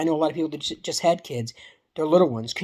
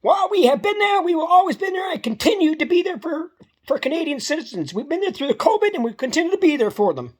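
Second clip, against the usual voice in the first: mocking Trudeau; 12 semitones higher than usual. Mimicking Trudeau to viewers, high mocking politician voice. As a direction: parody the prime minister's empty promise, pitched up. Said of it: "The Honorable Trudeau is about to speak".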